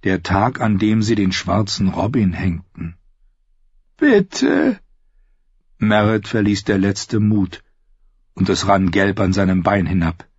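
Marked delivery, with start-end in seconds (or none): 4.0-4.8 high, desperate little plea; pleading. Acted. Captive begs the outlaws as the singer plays, pleading. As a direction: beg for your life.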